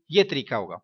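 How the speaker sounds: ordinary talk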